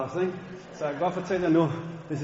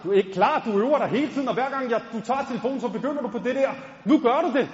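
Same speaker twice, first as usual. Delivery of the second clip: high, louder pushing; pushy friend; 6 semitones higher, 3 dB louder than usual. Retold or imitated. Friend Josef urging him to rap, high and insistent. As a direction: push him hard to do it.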